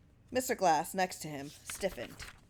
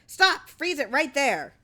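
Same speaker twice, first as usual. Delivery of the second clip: shrill, much higher, much louder yell; 8 semitones higher, 8 dB louder, slower than usual. A narrator's reading. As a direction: scream at the projectionist to stop. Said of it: "Clarence yelling".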